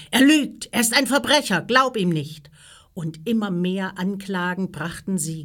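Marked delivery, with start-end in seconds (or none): 0.1-2.5 high, loud, urgent outcry; shouted accusation. A narrator's reading. The others accusing Jesus to Pilatus, shouting. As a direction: shout him down as a liar. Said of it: "die anderen riefen".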